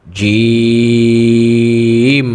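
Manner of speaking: জিম chanted long from inside the mouth; hard long Jim. Shown as a demonstration. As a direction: give জিম a firm, drawn-out sound. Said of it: "মুখের ভিতর থেকে শক্ত আওয়াজ করে". (শক্ত আওয়াজে লম্বা জিম)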